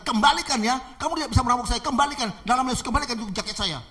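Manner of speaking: high, loud insistent order; commanding. Acted. Pastor ordering the robber to return his jacket, commanding. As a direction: hammer out the demand.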